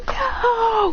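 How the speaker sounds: a squeaky panicked no; high no. Retold or imitated. Villains try stopping the effect, high no. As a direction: cry it out way up high. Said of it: "they say".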